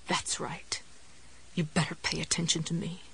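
whispered hoarse warning. Acted. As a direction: hiss the warning with no voice. Jessie hoarsely warns the dog.

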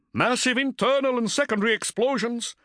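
high-pitched bridge officer reporting damage; urgent crewman calling out damage; officer's urgent report. A narrator's reading. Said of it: "snapping off further reports".

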